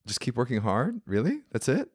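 mock-baffled, each question lifting; incredulous. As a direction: push back in disbelief. Retold disbelief at the advice.

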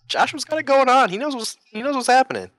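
high-pitched impressed cheer; mock hype. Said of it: "I'm like".

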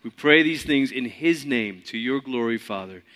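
soft, hushed closing prayer. Acted. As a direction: pray it quietly to the end.

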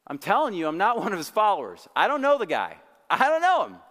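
loud, high protest building to a yell; acted denial that climbs sharply on the last sentence; emphatic denial. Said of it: "he emphatically denied him three times".

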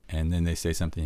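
ordinary talk, nothing marked

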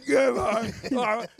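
high, loud mock cheer; re-enacted burst of celebration; celebrating supporters. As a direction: cheer the score like a fan. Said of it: "when Argentina would score, we'd be like".

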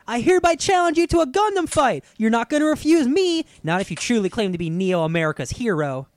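loud, high, slow heroic challenge; dramatic anime quote. Retold or imitated. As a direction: declaim it like an anime hero. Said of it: "can I say a quote real quick".

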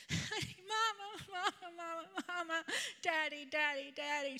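put-on high, wailing little-child voice; mock toddler pleading for parents; imitated crying toddler. Grandma imitates toddler crying at bedtime, mock wail. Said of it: "she's, she does this. She's like".

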